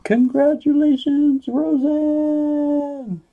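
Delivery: high, loud game-show shout of the winner's name; excited winner announcement. Real, not acted. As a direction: proclaim the winner like a host.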